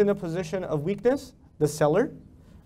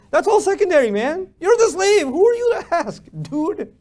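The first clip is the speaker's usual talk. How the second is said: loud, high-pitched indignant rebuke; exasperated outburst; 11 semitones higher, 8 dB louder than usual. Real, not acted. Preacher rebuking whoever questions the master's choice, exasperated outburst. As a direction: raise your voice sharply in exasperation.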